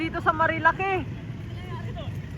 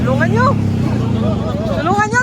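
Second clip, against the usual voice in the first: calling out; 4 semitones higher, much faster than usual. Real, not acted. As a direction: shout it to the crowd. Calling out for help loudly.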